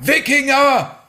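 one high, panicked cry; high-pitched scream. Acted. Peter bursts into the station to warn his colleague, screaming.

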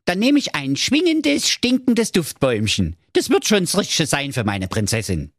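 put-on high-pitched eager young man; Marco, high voice. Marco asks the grumpy dwarf for a tree, in a high voice. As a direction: pipe it up brightly, full of hope.

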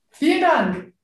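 ordinary talk

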